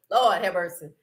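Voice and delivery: ordinary talk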